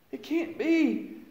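high, slow "It can't be." falling away; slow high lament, letting it fall; slow, pained denial. Retold or imitated. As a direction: drag it out as the truth sinks in. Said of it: "And I was like".

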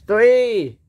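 high, loud, drawn-out count; ringing countdown call. Acted. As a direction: call out the count before a charge. Countdown before a charge.

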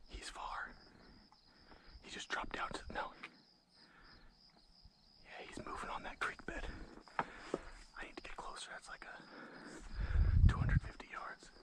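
hushed hunter whisper; breathy whisper to stay hidden. Real, not acted. Whispers while stalking coyote.

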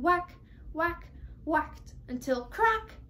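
slow, punched whacks rising to a big crack; chopping sound words. A narrator's reading. Narrator acting out the axe felling the tree, slow and punchy. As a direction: hit each whack like an axe blow.

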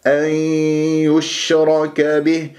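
"أن يشرك به" chanted slowly, n melting into a nasal y; slow recited "أن يشرك به" with nasal merging; merged with ghunna. Shown as a demonstration. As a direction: hum the join of "أن يشرك" through the nose. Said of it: "بهذه الطريقة".

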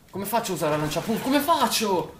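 high, loud exasperated outburst; frustrated shout. Real, not acted. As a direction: rage at the weapon. Panicked frustration in game.

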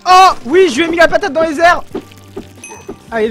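normal talking voice

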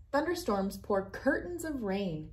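ordinary delivery

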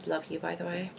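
dry, quiet sarcasm; sarcastic. Real, not acted. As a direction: say it drily, not meaning it. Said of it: "Okay, that was sarcasm at its finest".

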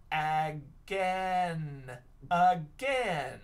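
'Again' drawn out slowly in two syllables; blending slowly. Shown as a demonstration. Teacher blending a word for pupils, sounding out. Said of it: "try and sound this out".

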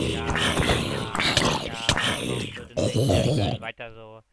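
loud, eager cheering; excited cheering. Real, not acted. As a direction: cheer the progress on. Cheering game progress.

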